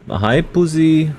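cooing at the cat. Real, not acted. Streamer greeting his cat, slow high cooing. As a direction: coo it to the cat, drawn out.

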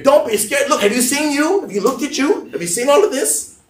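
high-pitched acted disbelief; mock-incredulous, pitched well up; incredulous retort. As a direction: fire back questions at an absurd reassurance. Women answer angel in disbelief.

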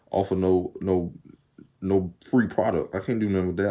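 normal talking voice

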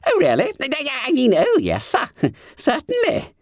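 high squeaky startled stammer; flustered fat schoolboy. A narrator's reading. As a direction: squeal it, caught out. Bunter flustered.

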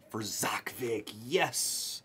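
slow, whispered celebration; hushed whisper. Real, not acted. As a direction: whisper it, savouring the big hit. Whispered reveal of a big hit.

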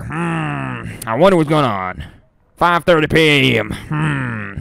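slow, drawling investigator impression; character voice. Streamer voicing the man studying the spot, mock musing.

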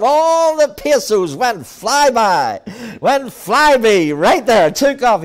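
loud, high, driving sermon storytelling; full-force preaching. Acted. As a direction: belt the story out to the congregation.

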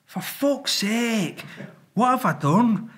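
high, drawn-out mock wail; hammed-up anguished whine; exasperated dismay. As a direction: replay the despair at your own blunder. Retelling his dismay after crashing the car.

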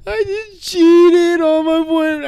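high, slow, loud fake bawling; hammed-up sobbing imitation; mock crying. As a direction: wail it like a tearful confession. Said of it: "are you crying for".

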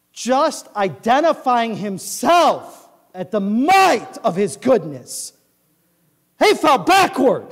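loud, slow pulpit proclamation; preaching at full force. Acted. Preaching climax.

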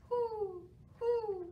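high hooting owl call. Pretend. Librarian hoots like an owl for children, high hoot.

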